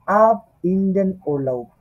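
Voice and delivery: "আব Indane ওরলাউব" drawn out slowly, word by word; slow model reading. Shown as a demonstration. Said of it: "ওরলাউব নিয়ে যত রকমের".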